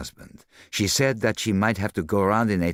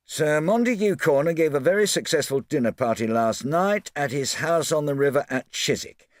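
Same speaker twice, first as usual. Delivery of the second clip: Japp reading a society column in a raised voice; reading aloud; 7 semitones higher than usual. A narrator's reading. Japp reads the paper to Poirot, mildly pompous. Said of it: "Japp read the item aloud".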